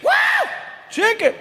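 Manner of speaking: high gleeful yelps; hammed-up gleeful shouts; excited squeal. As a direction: squeal with delight at each item. G gleeful over the basket.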